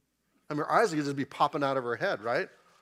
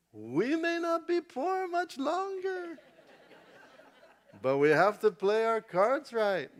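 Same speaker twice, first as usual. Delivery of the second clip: scheming mother-in-law; 10 semitones higher, slower than usual. Acted. Naomi's inner thoughts voiced.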